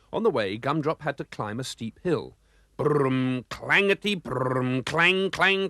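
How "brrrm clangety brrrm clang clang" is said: clanging engine. Acted. Gumdrop climbing the steep hill, rumbling and clanging. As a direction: make the engine clatter uphill. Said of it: "clang went the engine".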